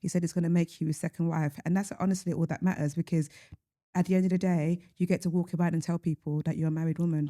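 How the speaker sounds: deadpan mock advice; dry, understated sarcasm; sarcastic. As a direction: give awful advice as if sincere. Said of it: "I hope you hear the sarcasm".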